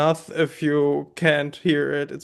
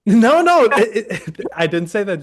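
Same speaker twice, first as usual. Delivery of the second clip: laughing hard, higher and louder; real laughing no-no, pitched up and loud; laughing denial; 4 semitones higher, 6 dB louder than usual. Real, not acted. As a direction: protest through laughter. Denying a joke he didn't make.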